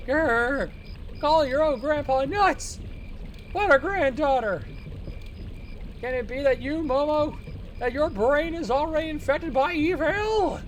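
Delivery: put-on high, loud indignant old man. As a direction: growl it like an offended grandpa. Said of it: "the old man voice".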